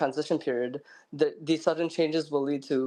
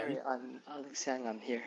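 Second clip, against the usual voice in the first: barely audible; 11 dB quieter than usual. Real, not acted. Speaking on a muted line.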